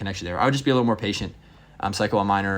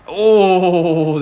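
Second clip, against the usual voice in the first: real surprised oh; 8 semitones higher, 10 dB louder than usual. Real, not acted. Near miss on tower.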